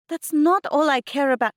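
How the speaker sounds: high, loud defensive protest; heated denial. A narrator's reading. Mum denying daughter's accusation, heated. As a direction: argue back forcefully.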